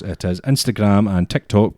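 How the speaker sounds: usual speaking voice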